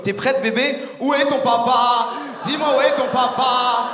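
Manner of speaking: high, slow, sing-song creepy voice; hammed-up sinister baby talk; creepy doll talk. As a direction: tease a doll like a weirdo.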